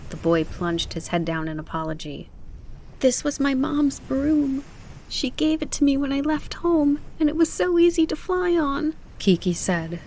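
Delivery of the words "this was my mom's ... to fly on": tearful. A narrator's reading. Kiki tells the boy about her mom's broom, tearful. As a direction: hold back tears as you speak. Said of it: "her voice full of tears".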